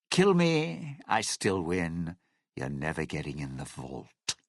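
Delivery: low, gloating refusal; cold defiance. Acted. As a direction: drop low and taunt your would-be killer. Defiant threat to the would-be killer.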